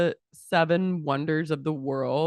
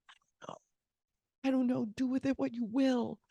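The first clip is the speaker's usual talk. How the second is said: soft start, then high-pitched resignation; imitated despair; 6 semitones higher, 7 dB quieter, faster than usual. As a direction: play her distraught surrender, small and high. Acted Sam, face down in despair.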